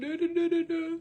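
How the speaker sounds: high, loud mocking da-da-da; mocking singsong. Mocking her announcement of quitting TikTok, high singsong blah-blah. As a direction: dismiss it with shrill-pitched nonsense syllables.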